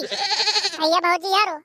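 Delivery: slow drawn-out announcer greeting; outro call. Acted. Host greeting viewers with a drawn-out call. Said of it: "अगर आपको हमारी थोड़ी सी भी video".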